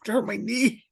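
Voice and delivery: high-pitched, strained plea that it is no joke; retold pained plea. Retold plea to joking coworker.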